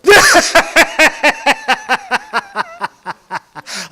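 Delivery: high far-off witchy cackle; evil cackle. Acted. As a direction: cackle like a monster in the dark. Spooky story villain laughs.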